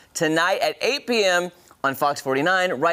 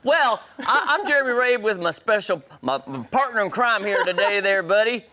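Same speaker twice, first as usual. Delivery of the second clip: mild Texas twang; 6 semitones higher than usual. Pretend. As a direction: keep the Texas host voice going lightly. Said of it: "my Texas is coming out".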